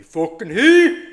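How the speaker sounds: a loud burst at the screen; real anger spilling out; sudden yell. Real, not acted. Trader yelling at the market, sudden yell.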